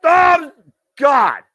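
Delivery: yelling the near fall, high and loud; excited shout. Real, not acted. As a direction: scream the call with the crowd. Big near fall, live call.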